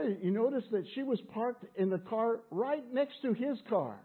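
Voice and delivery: ordinary talk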